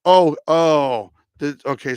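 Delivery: ordinary delivery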